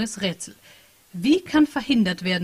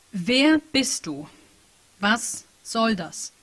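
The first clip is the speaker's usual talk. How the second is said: slow tense question; much slower than usual. Acted. Typing to the unknown hacker.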